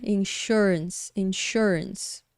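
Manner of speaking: insurance said twice, slowly, SUR stressed; model pronunciation. Shown as a demonstration. Teacher repeats insurance slowly for the class. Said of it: "ele tem um som de".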